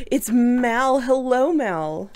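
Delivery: gasp, then high, loud, delighted hellos; high, loud delighted welcome; excited greeting. Real, not acted. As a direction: greet a friend who just showed up, thrilled. Greeting Mal arriving on stream.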